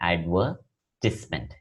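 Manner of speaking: advertisement said very slowly as ad-VER-tis-ment, short "tis"; slow model pronunciation. Shown as a demonstration. Teacher models the noun for the class, very slow and deliberate. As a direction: drag out advertisement syllable by syllable, "tis" not "tize". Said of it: "it is pronounced as".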